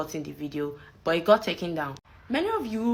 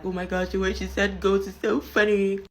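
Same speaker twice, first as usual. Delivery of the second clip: mildly mocking imitation of a classmate; a touch of gushing, mocked amusement; lightly mocked schoolgirl; 4 dB louder than usual. Girl picks at classmate's word, lightly mocked amusement. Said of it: "this one stupid, very, very stupid girl".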